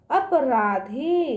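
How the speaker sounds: अपराधी slowly, ending on a stretched धी; long final ee. Shown as a demonstration. Teacher checking children understood, अपराधी with long ending. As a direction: let the ee at the end of अपराधी run long. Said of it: "इसका उच्चारण दूर तक जाएगा".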